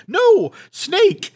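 high, loud frightened cry; urgent high warning; alarmed cry. Retold or imitated. Marion points at the snake.

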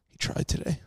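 breathy sigh, then the words unvoiced; sighing wife. Imitating his wife's weary sighing approval. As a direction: sigh it out, half-defeated. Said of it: "she'll be like".

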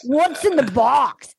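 slow, drawn-out movie-quote delivery; overdone dramatic voiceover imitation; bad voiceover. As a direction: stretch it out like a hammy voiceover. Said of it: "they have the worst voiceover".

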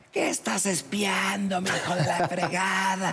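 high, slow mocking voice of the angry woman. Storyteller imitating his ex scolding him, high and slow. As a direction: play the drunk ex catching him spying.

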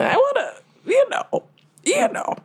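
very high, coy sing-song hint; coy falsetto. Acted. Telling the group about the mansion, a coy high-pitched hint. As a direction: squeak it out suggestively.

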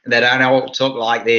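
ordinary talk

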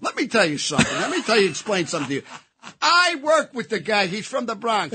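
puffed-up know-it-all lecture; cocky. Retold or imitated. Husband cockily lecturing his wife about the actor, smug and swaggering. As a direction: lay it on with smug confidence. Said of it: "the cockiness".

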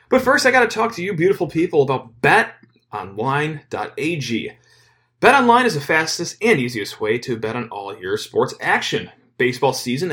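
punchy podcast ad read with stretched key words; sponsor ad read. Acted. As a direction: sell it with lively, swinging pitch. Host reads sponsor ad.

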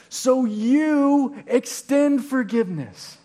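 slow, pointed delivery, punching every "you"; stress on YOU. Shown as a demonstration. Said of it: "put the emphasis on a word".

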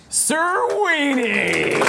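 high, drawn-out excited call; excited announcement. Real, not acted. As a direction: call the name out with delight. Announcing arriving guest.

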